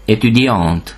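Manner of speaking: étudiante with the T pronounced before the silent E; French étudiante, closing on a clear T; final consonant sounded. Shown as a demonstration. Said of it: "ha e betű is követi".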